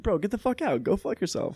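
retold angry outburst, pitched high; high, indignant mock confrontation; pissed-off retort. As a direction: re-enact telling off a heckler. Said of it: "And I got so pissed".

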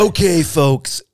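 loud, drawn-out Trump voice; hammed-up Trump, high and slow; Donald Trump impression. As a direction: open like Trump addressing a crowd. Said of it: "best Donald Trump impression".

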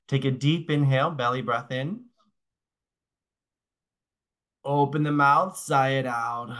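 calm, unhurried breathwork guidance with long pauses; guided breathing cues. Acted. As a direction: lead the class through each breath gently.

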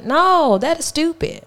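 high emphatic disbelief. Real, not acted. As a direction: stress every very, high. Emphatic rejection.

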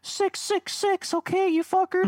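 put-on very high, slow boyish voice; little boy Crowley voice. Showing friends how Crowley really talked, very high and slow. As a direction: play Crowley as a squeaky little kid. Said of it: "he talks like a little boy".

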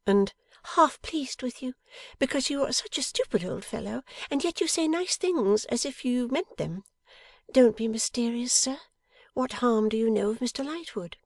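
high-pitched teasing wife; Bella, fondly scolding wife. A narrator's reading. Wife questions her mysterious husband, playfully cross.

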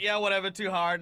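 loud, high mock brush-off; dismissive guys. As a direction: toss it off like bored guys. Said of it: "two guys are like".

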